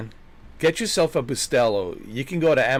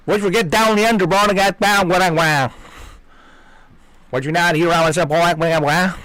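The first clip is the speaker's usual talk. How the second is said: put-on loud, higher, garbled swamp Southern accent; thick swamp Southern; 6 semitones higher, 6 dB louder than usual. Swamp dweller talking to an outsider, thick Southern accent. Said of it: "thick swamp southern accent".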